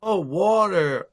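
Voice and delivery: slow, drawn-out American "water"; mock American accent. Imitating Americans who finally understand, exaggerated American accent. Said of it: "I used to say".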